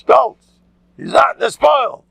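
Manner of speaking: high, loud mocking jeer; mock shout of a proud schnook. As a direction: sneer at his pride.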